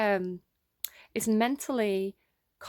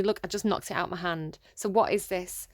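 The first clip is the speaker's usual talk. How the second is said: ordinary talk, nothing marked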